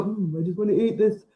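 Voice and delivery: lightly voiced smug meat eater savouring a sausage; mock meat eater. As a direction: mimic a greedy sausage lover, lightly. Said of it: "'cause you could go".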